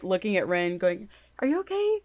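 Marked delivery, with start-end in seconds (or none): 1.4-2.0 high-pitched worried question; concerned. Acted. Lola looking at Ren, concerned and high-pitched. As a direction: fuss with a much higher voice. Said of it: "too concerned".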